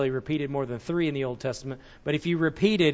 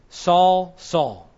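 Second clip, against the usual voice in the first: first Saul called out louder, second falling; emphatic double call; 2 semitones higher, 4 dB louder than usual. Shown as a demonstration. Preacher voicing God's double call to Saul. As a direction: call the name twice for emphasis. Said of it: "That's a very Old Testament style".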